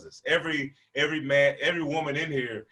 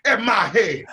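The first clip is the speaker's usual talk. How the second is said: slow, loud, higher, laughing; mock tough yell; 9 semitones higher, 9 dB louder, slower than usual. Kid demanding who threw the book, mock tough yell. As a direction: bellow it trying to sound hard. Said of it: "I'm like".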